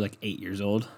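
ordinary talk, nothing marked

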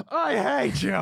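high, laughing fake anger; jokingly through a laugh; laughing mock hate.

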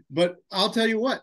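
ordinary talk, nothing marked

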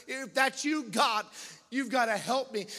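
loud, high retold plea to God; crying out. Addict begging God for help, crying out. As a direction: cry out a desperate prayer, as retold. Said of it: "And I'm crying out".

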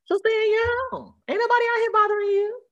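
high, strained, near-crying cry; exasperated outburst. Real, not acted. Telling the nosy neighbor off, high cracking exasperation.

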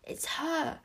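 high, breath-held whisper; whispered under breath. A narrator's reading. Wish spots her mother below, whispering. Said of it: "whispered under her breath".

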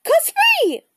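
high, loud bird screech; raven croak. A narrator's reading. Annie the raven urging Jack to fly, high squawk. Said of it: "croaked Annie".

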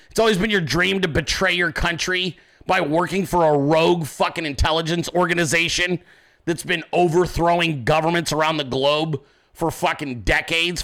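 normal talking voice

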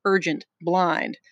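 ordinary delivery, nothing marked

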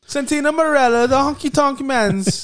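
put-on a clumsy stab at Santino's Italian accent; Santino Marella accent. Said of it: "I can't do his accent".

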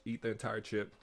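ordinary talk

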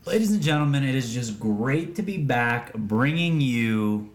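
lightly hammed host welcome; announcer voice. Podcast intro announcement.

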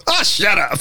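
high-pitched mock dismissal; imitated brush-off. Cops dismissing the waiter's answer, dismissive. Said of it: "And they go".

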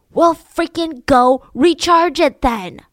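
loud, slow, clipped command; angry order. Retold or imitated. Sailor ordering his crewmate to recharge the radio, angry. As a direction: bite off each word at him. Bob snapping at Jim.

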